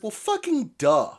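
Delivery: high-pitched mock exasperation; sarcastic duh. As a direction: say it like it's blindingly obvious. Mock exasperated reaction to a line.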